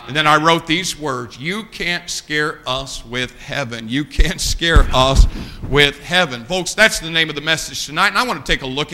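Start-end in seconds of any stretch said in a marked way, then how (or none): 1.4-6.4 slow, low, measured pulpit delivery; solemn declaration. Real, not acted. Preacher reciting the words he wrote, solemn declaration. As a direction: let each word land slowly.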